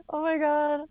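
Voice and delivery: high-pitched, helpless laughter; laughing too hard to speak; real laughing. Real, not acted. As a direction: gasp it out while cracking up. Group cracking up at joke.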